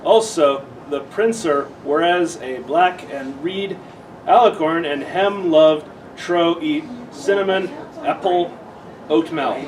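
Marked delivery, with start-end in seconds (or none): none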